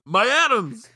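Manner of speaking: very high mock wail; imitated teacher's cry. Host copying the way the teacher says it, mock-distraught cry. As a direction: yelp it high, hammed-up dismay. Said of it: "the way he just says it".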